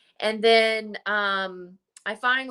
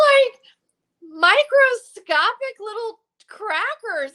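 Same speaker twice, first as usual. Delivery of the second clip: much higher, louder laughing outcry; shocked disbelief; 13 semitones higher, 7 dB louder than usual. Real, not acted. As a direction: squeal in disbelief at the tiny crackers. Shocked at tiny crackers.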